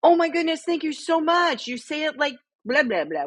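very high, gushing delight; gushing thanks. Retold or imitated. Tourist thanking the waiter who corrected her French, gushing and very high. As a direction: overflow with grateful excitement. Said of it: "I was like".

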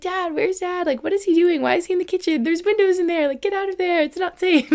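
put-on high-pitched, panicky kid retelling; scared child. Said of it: "crying and scared".

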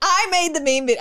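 high helpless laughter; laughing hard. Real, not acted. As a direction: laugh till you can't speak.

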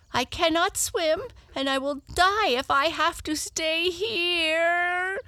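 high, despairing wail; tearful Tearful. Acted. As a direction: whine it out, on the verge of crying. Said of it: "then she began to cry again".

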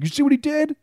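very high, incredulous tattling; mock outraged complaint. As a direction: imitate an indignant player appealing to the umpire. Acting out pitcher complaining to umpire.

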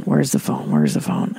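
low grumbling worry; mock anxious. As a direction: sink the voice down, fretting. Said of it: "mental energy to".

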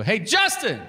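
high, projected shout; calling out. Retold or imitated. Host calls to guest at the elevator, shouting. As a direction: shout the name after someone leaving. Calls down hall to departing man.

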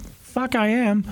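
sudden high panicked yelp; shocked yelp. Retold or imitated. Realizing he must pay for the press, panicked yelp. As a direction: squeak it out in alarm.

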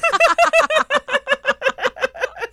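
squealing burst of laughter; high squeaky laugh. Real, not acted. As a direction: break into a high giggle fit. Big laugh at meme.

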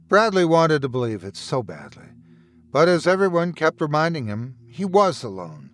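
ordinary delivery, nothing marked

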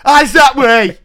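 high, loud order through laughter; laughing shout. Pretend. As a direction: bark an order while laughing. Mock teacher ordering kids.

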